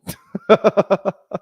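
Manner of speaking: a loud, high laugh out of nowhere; burst of high laughter. Real, not acted. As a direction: crack up at himself. Laughs at his own search.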